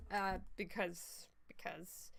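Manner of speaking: fading unvoiced mumble; whisper. Real, not acted. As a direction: trail off into a whisper. Confused whispered trailing.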